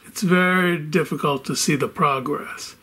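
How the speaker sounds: normal talking voice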